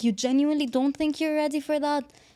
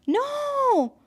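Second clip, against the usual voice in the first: sharp high-pitched NO; shrieked no; 12 semitones higher than usual. Real, not acted. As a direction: cut her off with a high cry.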